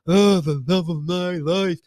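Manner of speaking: drawn-out, lovey-dovey recital; mock gushing. Mocking a coworker's Facebook anniversary post, mock gushing. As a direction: slowly overdo the sappy devotion, mocking it.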